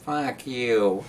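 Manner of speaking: slow squawky parrot voice; cussing parrot. Retold or imitated. Parrot answering the cracker offer, imitated bird voice. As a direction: imitate a parrot drawing out each word. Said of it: "And the bird looks at him".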